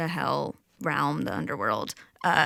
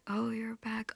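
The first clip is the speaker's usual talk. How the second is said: soft echo; 4 semitones higher, 9 dB quieter than usual. Acted. Creatures quietly echoing the goddess's pain, soft murmured echo. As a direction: murmur it back gently. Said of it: "And they say".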